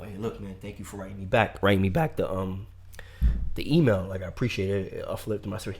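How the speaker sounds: soft, low, polite thanks; quiet thanks. Retold or imitated. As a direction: thank him quietly off to the side. Quietly thanking him aside.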